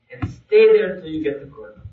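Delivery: normal talking voice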